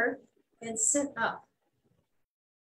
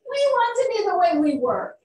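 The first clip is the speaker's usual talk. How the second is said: loud, fast, higher demand; insistent chorus; 7 semitones higher, 9 dB louder, faster than usual. Acted. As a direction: insist as a crowd. Animals insist together.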